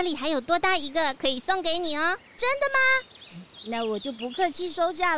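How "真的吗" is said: high, bright-eyed "really?"; pleased disbelief at the gift; delighted surprise. Acted. (惊喜地问)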